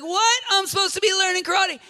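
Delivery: indignant student. Retold or imitated. Preacher voicing Daniel, high and indignant. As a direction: protest like a cheated teenager. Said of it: "He's like".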